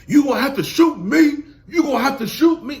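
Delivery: loud, high imitation of him daring them; mock loud threat. Vlogger imitates the man daring them to shoot, loud and high. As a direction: shout it as a hammed-up dare. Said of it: "you talking about getting shot".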